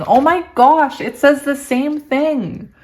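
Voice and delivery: high, loud, thrilled outburst; gasping with delight at the card; real excitement. Real, not acted. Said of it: "I'm sorry, I'm so excited".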